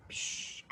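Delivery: unvoiced puff of smoke; breathy magic poof; whooshing puff. Flash and smoke effect.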